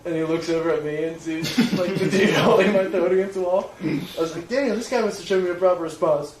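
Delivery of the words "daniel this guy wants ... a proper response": raised, higher-pitched call for backup; recounting a shout to a friend; retold call.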